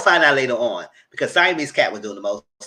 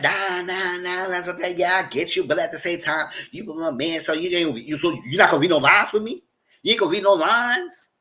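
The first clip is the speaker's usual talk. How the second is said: imitating her protest; 6 semitones higher, 3 dB louder than usual. Retelling her pushback.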